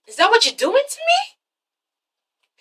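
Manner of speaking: high, drawn-out pleading question; mock-hurt accusation. Boyfriend accusing girlfriend of lying, mock-hurt. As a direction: stretch it upward, playing wounded.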